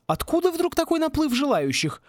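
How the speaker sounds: high, probing female character voice; high-voiced suspicious questioning. A narrator's reading. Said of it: "подозрительно спросила мисс Сьюзен".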